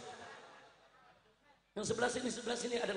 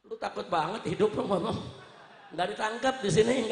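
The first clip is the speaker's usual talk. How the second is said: ordinary talk, nothing marked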